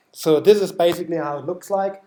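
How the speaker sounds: usual speaking voice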